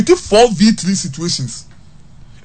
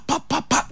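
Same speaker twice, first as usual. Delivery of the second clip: imitated quick passes; 9 semitones higher, 10 dB quieter than usual. Mimics quick short passing.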